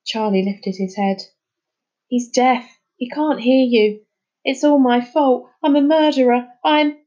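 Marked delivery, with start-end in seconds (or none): 2.1-7.0 louder guilty outburst; despairing. Acted. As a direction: blame yourself in despair.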